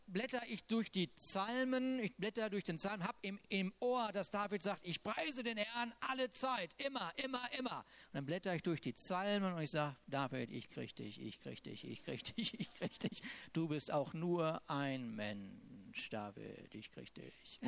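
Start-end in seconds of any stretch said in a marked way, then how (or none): 4.8-7.8 lifted, fervent reading of scripture. Acted. Quotes David praising. 10.1-17.6 low, hushed gloating taunt; playful mock menace, dropping to a near whisper; mean teasing. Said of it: "Bisschen gemein ist das".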